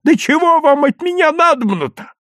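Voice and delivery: very high, thin-voiced protest; exasperated squeal of a question; thin, high cry. A narrator's reading. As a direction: cry out like a flustered old woman. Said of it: "тоненько закричала".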